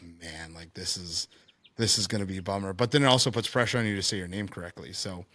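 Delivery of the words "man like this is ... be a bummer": low, quiet groan of disappointment; imagined weary student, subdued and low; dejected muttering. Retold or imitated. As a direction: mutter it low, resigned to a bummer. Waiting student's dejected inner reaction.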